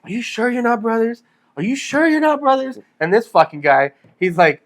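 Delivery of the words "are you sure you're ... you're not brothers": high, rising mock-incredulous repeat; mimicking the wife. Wife asking the two friends, high puzzled voice. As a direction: play the puzzled wife, going higher the second time. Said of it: "she's like".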